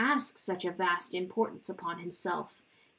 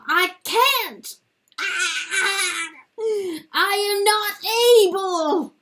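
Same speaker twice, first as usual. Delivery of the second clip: high, loud, voice cracking into sobs; breaking down; 9 semitones higher, 10 dB louder than usual. Acted. Rooster confessing shame to his wife, breaking into sobs. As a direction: let the confession crumble.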